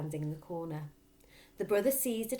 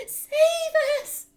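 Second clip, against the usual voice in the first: very high, loud scream; mother begging in terror; terrified scream; 15 dB louder than usual. Acted. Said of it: "the mother screamed".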